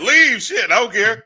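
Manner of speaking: high, loud dismissive shout; defiant shout. Real, not acted. Yelling at imagined sponsors, defiant and loud. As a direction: yell it off with a laugh.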